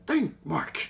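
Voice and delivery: light Omni-Man imitation; Omni-Man quote. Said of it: "Invincible with Omni-Man".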